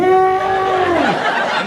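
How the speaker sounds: high, slow, wondering tone; marveling in a high drawn-out voice; mock excited. Guys at the shop marveling, high and slow. Men reacting to dildo demo.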